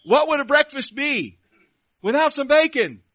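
high, loud voice of a farm animal; loud exclaimed plea. Acted. Cow asking the pig for bacon, loudly exclaiming.

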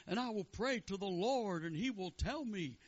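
high-pitched comic rabbi. Acted. Rebbe planning to pray to the Lord, high voice. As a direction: raise it high like a resolute joke rabbi. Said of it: "But he says".

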